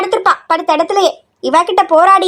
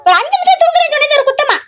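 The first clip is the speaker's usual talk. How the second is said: high, whiny daughter talking back; annoyed daughter; 8 semitones higher than usual. Acted. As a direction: sulkily complain about mother's nagging. Daughter role, annoyed retort. (எரிச்சலுடன் திருப்பிப் பேசும் மகள்)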